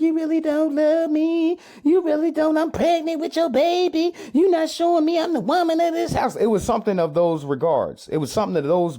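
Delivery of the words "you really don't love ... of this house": high falsetto imitation of the crying girlfriend; whiny girlfriend. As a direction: squeak it out like a wronged pregnant girlfriend. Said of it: "She was like".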